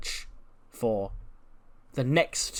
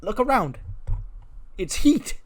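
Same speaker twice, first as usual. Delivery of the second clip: much higher, sneering disdain; scornful; 11 semitones higher, 4 dB louder than usual. Retold or imitated. Becky sneering to Kiana about being on Heat, high and scornful. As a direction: say it like the place is beneath you.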